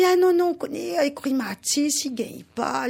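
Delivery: voix de cochon plus haute, qui se plaint; cochon plaintif. Acted. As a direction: refuse poliment, tout perclus de rhumatismes. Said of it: "Cochon, il dit". (le cochon décline l'invitation, voix haute et plaintive)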